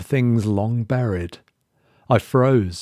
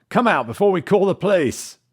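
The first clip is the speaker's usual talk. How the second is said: higher, threatening call; 9 semitones higher, faster than usual. A narrator's reading. Ted calls into the cellar.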